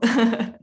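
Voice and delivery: quick burst of laughter; short laugh. Real, not acted. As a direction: laugh it off briefly. Laughs agreeing not boring.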